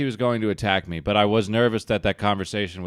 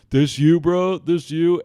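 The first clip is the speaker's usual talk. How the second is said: teasing friends; 8 semitones higher, 8 dB louder, slower than usual. Retold or imitated.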